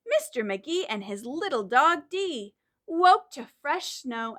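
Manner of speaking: lively storybook reading; reading to children. Acted. Teacher reads the story to the class. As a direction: read it like a story for little ones. Reads picture book aloud.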